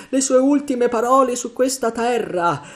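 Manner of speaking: high, loud wail; terrified moan. A narrator's reading. Morgan recalling Flint's dying words, loud moan. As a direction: wail it out in horror. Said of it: "gemette Morgan".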